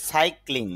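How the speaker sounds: Cycling with a push on সাই; stressed first syllable. Shown as a demonstration. Teacher showing the stress mark in practice, stressed. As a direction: lean on the first part of cycling. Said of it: "একটু চাপ দিতে হবে".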